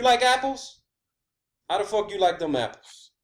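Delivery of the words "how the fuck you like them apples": ordinary delivery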